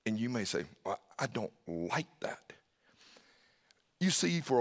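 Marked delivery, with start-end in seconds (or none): 0.8-2.5 soft whisper; hushed, private approval; whispered reaction. Acted. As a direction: breathe it out under your breath. Listener's inner objection to God.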